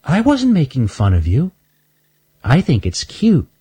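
put-on high, sweet little girl squirrel; little girl squirrel calling from behind a bench. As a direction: ask it like a curious little girl. Shelby reassures.

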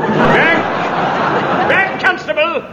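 high commanding shout; sharp order. Acted. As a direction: bark them back sharply. Inspector orders constable back.

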